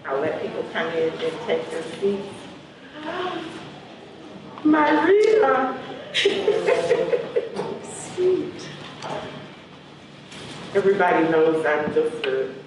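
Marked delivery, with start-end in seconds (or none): 2.9-3.8 sharp excited intake of breath; delighted gasp. Real, not acted. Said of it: "hard to contain myself". 4.6-5.8 high, loud, drawn-out delighted call; delighted greeting. Real, not acted. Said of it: "hard to contain myself in their presence". 5.8-9.4 high breathy laughter; delighted laugh. Real, not acted. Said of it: "hard to contain myself".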